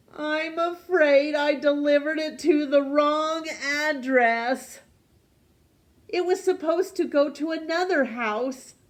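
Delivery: high, loud apologetic postman; postman's voice. A narrator's reading. Postman character voice.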